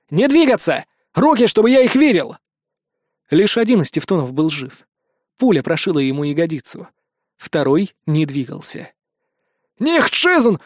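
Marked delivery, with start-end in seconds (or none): 0.1-2.4 shouting order. Acted. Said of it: "проорать". 9.8-10.6 high, strained pleading cry; desperate wailing cry. Acted. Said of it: "надрывался охранник".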